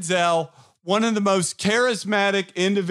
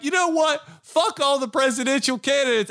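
excited rant; 8 semitones higher than usual. Retold or imitated. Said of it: "I'm like".

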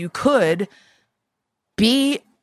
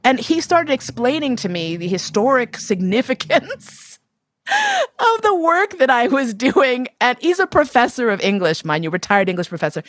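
ordinary talk

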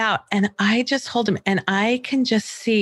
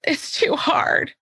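put-on whiny child; 7 semitones higher, slower than usual. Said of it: "actual things that she said to me".

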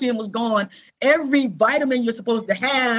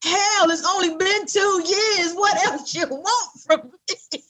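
higher and slow, fed up with all the loss; exasperated outburst; 7 semitones higher than usual. Retold or imitated. Telling a friend how he reacted, slow, higher, fed-up outburst. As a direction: drag it out like you've had enough.

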